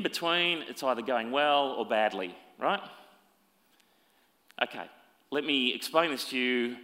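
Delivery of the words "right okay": ordinary talk, nothing marked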